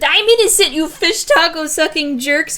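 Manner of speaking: high, loud protest, slowing on the insult; mock indignant yell. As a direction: yell the silly insult at the accusers. Accused character shouting insult.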